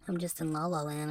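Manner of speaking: normal talking voice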